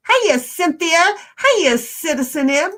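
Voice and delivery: reading a chat greeting from a friend, bright singsong; retold greeting. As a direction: read the friendly greeting out cheerfully. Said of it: "seventeen seventy-six says".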